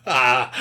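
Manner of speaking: sudden loud burst of laughter on "Ah"; loud laughing ah. Real, not acted. Bursts out at absurd claim.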